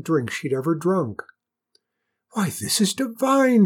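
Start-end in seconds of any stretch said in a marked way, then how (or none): 2.3-3.7 high, loud rapture; soaring, thrilled exclamation; delighted cry. A narrator's reading. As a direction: cry out at a wonderful discovery. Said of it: "she cried".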